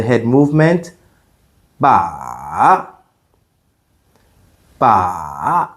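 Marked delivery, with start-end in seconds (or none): 1.8-2.9 "ba" dipping low, then rising; long low scooping "ba"; third tone. Shown as a demonstration. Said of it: "it goes down, then up". 4.8-5.7 "ba" dipping low, then rising; long low scooping "ba"; third tone. Shown as a demonstration. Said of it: "it goes down, then up".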